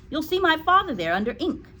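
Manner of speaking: put-on high-pitched little boy explaining; schoolboy voice. Eric answers in boy voice.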